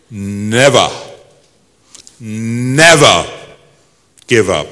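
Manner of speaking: put-on Churchill-style English accent, each word set apart; stately English accent, swelling on each "never"; slow, emphatic English oratory, falling away on "give up". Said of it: "I'll try in my best English accent".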